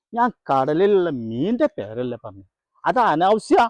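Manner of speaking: indignant. Acted. Indignant denial after accusation.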